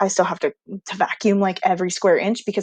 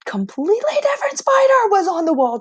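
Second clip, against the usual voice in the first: higher, louder rising alarm; reliving the discovery of yet another spider; alarmed exclamation; 12 semitones higher, 5 dB louder than usual. Real, not acted. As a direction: tell it as if it's still crawling there. Finding another spider at dawn.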